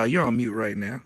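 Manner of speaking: usual speaking voice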